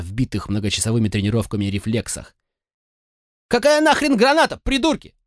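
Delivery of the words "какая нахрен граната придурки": high, loud scornful snap at idiots; angry snap. A narrator's reading. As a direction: scold them furiously. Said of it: "шикнул на них Слоун".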